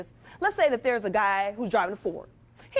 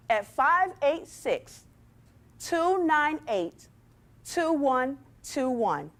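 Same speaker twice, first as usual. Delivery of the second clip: slow, clear number read; 2 semitones higher, much slower than usual. Shown as a demonstration. A slow careful dictation. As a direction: read the phone number out digit by digit, slowly. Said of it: "I want you all to text me".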